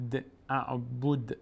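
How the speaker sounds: اعبد very slow with the final د bounced; slow recited اعبد with a rebounding dal; qalqala on dal. Shown as a demonstration. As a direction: let the د in اعبد echo with a bounce.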